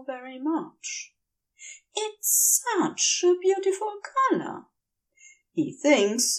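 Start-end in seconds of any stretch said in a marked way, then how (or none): 1.9-4.7 dreamy angel. Acted. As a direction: gush a little, half-drunk. Woozy angel admires hair.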